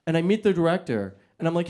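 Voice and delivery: usual speaking voice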